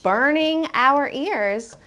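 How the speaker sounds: slow "burning" with the ing fully sounded; full -ing. Shown as a demonstration. Teacher showing what author could have said, full "burning". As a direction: pronounce the whole ending of "burning". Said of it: "The author could have said".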